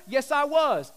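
"Yes, I was" with weight on "was"; stress on was. Shown as a demonstration. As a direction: hit the word "was" firmly. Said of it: "Emphasis on was".

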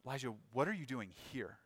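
soft appeal by name, weight on "here"; stress on here. Shown as a demonstration. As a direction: quietly land the question on "here". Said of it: "put the emphasis towards the end".

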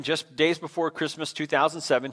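ordinary talk

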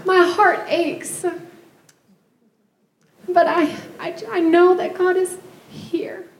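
real crying through a farewell; tearful. Real, not acted. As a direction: fight back tears while speaking. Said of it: "I wasn't gonna cry".

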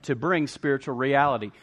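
loud, high, driving sermon delivery; full-force preaching. Real, not acted. Preacher rallying the congregation, loud and fervent. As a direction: preach it out, pounding each declaration.

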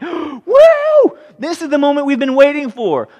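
very high, loud whoop of joy; hammed-up crowd whoop; excited cheer. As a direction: cheer like a fan at the big arrival. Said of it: "they're all coming along like".

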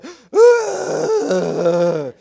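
big loud hammed-up gasp sliding down; dramatic gasp. Father mocks his dramatic son's sigh, loud drawn-out gasp. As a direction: overplay a theatrical son's long gasp. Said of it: "he'll go".

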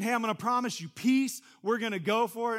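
hammed-up cheerful God; mock upbeat God. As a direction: pitch it like an excited invitation. God voiced as enthusiastic promise.

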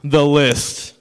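loud, ominous announcement; hammed-up doom, punched hard; name it like a dreaded monster; mock ominous. Dramatic naming of the dreaded list.

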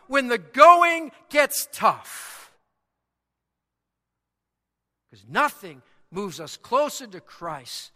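loud, high, ringing pulpit preaching; impassioned sermon climax; preaching at full force. Acted. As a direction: drive the point home to the congregation.

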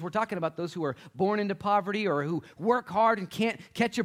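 high, loud pulpit delivery; full-force preaching. Real, not acted. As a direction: preach it out to the whole room.

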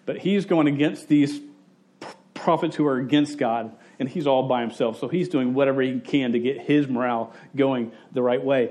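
ordinary talk, nothing marked

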